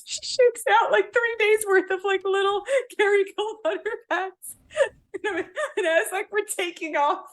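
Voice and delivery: high, squeaky words through real laughter; barely holding it together with laughter; laughing while talking. Real, not acted. Telling the butter haul story, helpless with laughter.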